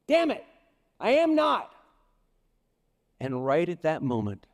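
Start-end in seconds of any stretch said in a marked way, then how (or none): 0.1-1.7 much higher and louder angry outburst; mock furious swearing, shouted up high; swearing denial. Peter swearing at his accusers, loud high shout. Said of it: "And he swore".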